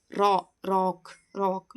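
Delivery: ರಾ, then ರಾಕ್, very slowly, dwelling on the open vowel; open "o" demonstration. Shown as a demonstration. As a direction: build ರಾಕ್ up from a drawn-out ರಾ. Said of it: "ಆ pronunciation ಆಗ್ತಾ ಇದೆಯಲ್ಲ".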